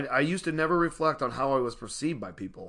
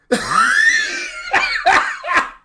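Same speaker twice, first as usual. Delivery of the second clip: loud high burst of laughter; a long loud belly laugh; big laugh; 10 dB louder than usual. Real, not acted. Speaker cracking up at his daughter's words, a big loud laugh.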